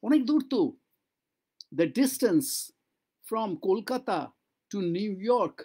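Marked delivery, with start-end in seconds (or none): none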